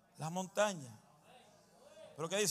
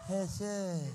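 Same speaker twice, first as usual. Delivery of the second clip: barely audible "Jesús", far softer; mock feeble call; 3 semitones lower, 14 dB quieter than usual. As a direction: mumble the name as a weak cry. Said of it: "cuando grita".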